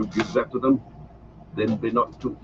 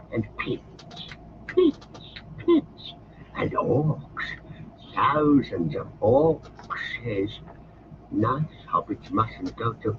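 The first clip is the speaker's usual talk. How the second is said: Gollum, frightened; much slower than usual. Acted. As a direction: dread in every word. Gollum fears Mordor.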